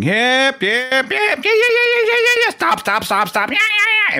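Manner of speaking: very high, loud, rapid yelling; hammed-up curler yelling yep and stop; frantic curling shouts. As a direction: bark it at the sweepers in a panic. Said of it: "they start shouting".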